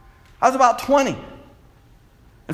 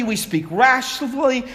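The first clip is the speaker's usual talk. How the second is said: ordinary delivery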